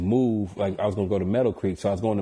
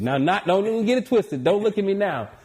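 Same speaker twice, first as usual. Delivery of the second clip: high, loud boastful hype; hyped bragging; 10 semitones higher, 3 dB louder than usual. Real, not acted. Bragging to the host about his playing days, hyped and high. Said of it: "hyped boasting about hoop days".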